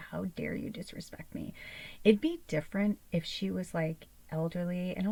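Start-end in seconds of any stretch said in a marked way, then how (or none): 0.1-1.5 seethe it under your breath; whispered fury. Retold or imitated. Whispered mock rage at cat.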